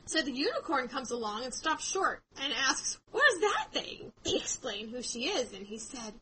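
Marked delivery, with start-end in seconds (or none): 3.1-4.1 high, loud startled question; acted astonishment. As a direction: unicorn taken aback at a strange creature.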